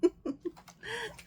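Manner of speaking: high rising giggle; light laugh. Real, not acted. Storyteller amused at the story's end, high giggle. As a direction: giggle at the ending.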